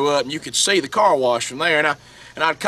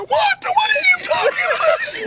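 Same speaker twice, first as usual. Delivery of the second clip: boss's high-pitched voice. Retold or imitated. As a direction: screech it high in disbelief. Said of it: "he said".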